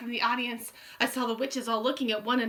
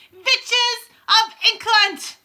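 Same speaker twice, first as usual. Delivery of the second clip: Grand High Witch yelling; 11 semitones higher, 12 dB louder, much slower than usual. A narrator's reading. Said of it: "she yelled".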